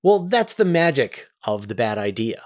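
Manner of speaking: usual speaking voice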